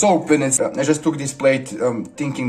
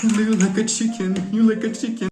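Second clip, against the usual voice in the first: mocking family members; 7 semitones higher than usual. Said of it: "They're like".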